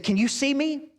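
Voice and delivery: reach her over a roaring crowd; calling out. Retold or imitated. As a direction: coach calling to his gymnast across the arena. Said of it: "He said".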